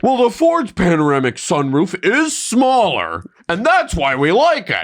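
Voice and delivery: high, mocking quote with a drawn-out ending; mocking imitation. Host ridiculing the reviewers' sunroof verdict, mocking high voice. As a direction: parody their silly reasoning, pitched well up.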